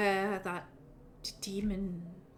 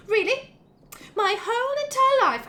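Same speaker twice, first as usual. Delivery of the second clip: high and loud, outraged; indignant squeal; 13 semitones higher, 6 dB louder than usual. Retold or imitated. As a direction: shriek it in disbelief. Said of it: "She goes like".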